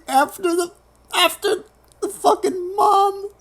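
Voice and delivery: high, squeaky voice cracking up; real laughing fit through the words; helpless laughter. Real, not acted. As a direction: squeeze the words out while losing it laughing. Breaking up over the stupid plot.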